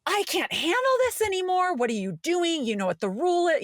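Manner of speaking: high, exasperated outburst; mock fed-up mom snapping at the kids; overwhelmed parent losing it; blowing up at kids. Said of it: "And be like".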